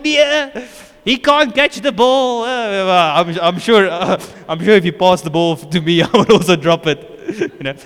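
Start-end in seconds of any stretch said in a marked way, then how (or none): 0.0-2.4 high, loud, drawn-out complaint; mock exasperated fan. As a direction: wail it like an armchair critic. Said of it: "I was, I was like".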